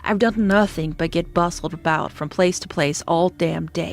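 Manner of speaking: ordinary delivery, nothing marked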